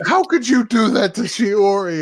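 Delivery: high, indignant protest; mock wounded sister. Sister reproaches brother, high mock hurt. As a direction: plead in a high put-on voice.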